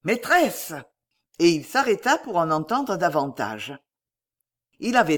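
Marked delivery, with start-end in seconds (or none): 0.0-0.7 mildly raised cry of "mistress"; calling out. A narrator's reading. Servant boy calling his old mistress, calling out. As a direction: call out to her, slightly pitched up. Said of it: "entendit crier".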